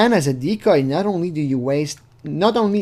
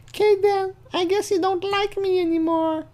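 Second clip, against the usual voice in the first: high falsetto, hurt and pouting; sulky girl voice. Retold or imitated. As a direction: pout it like a rejected teen. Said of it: "Kayla says".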